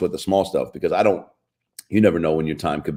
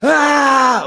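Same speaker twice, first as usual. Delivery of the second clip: very high, loud shriek of a laugh; hammed-up childish laugh; mock kid laughing; 6 dB louder than usual. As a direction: burst out like a kid cracking up. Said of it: "laughing so hard".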